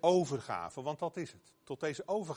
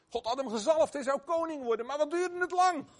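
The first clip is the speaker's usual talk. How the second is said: impassioned question; 12 semitones higher, 5 dB louder, faster than usual. Real, not acted. Preacher exclaims about David's wait.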